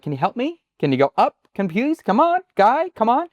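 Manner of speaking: high, rising pleas; sweet pleading voice climbing high. Shown as a demonstration. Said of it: "we kinda can go like".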